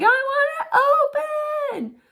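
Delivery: put-on high, loud, slow child's demand; imitating a bratty kid; whiny child. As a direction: whine it like a demanding toddler. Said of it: "and then they're like".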